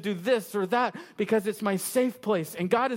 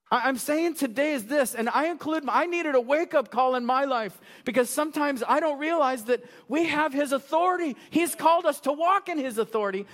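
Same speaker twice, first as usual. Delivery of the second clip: preaching at full force; 4 semitones higher than usual. Acted. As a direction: press the point home to the congregation.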